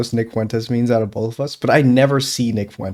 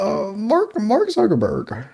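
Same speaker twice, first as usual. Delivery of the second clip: Muppet voice; 8 semitones higher, slower than usual. Pretend. Put-on voice saying the name.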